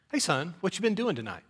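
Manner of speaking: soft, quick, casual question; nonchalant dad. Retold or imitated. Dad greets his son home at night, nonchalant. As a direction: ask it offhand, as if you know nothing. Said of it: "my dad just says very nonchalantly".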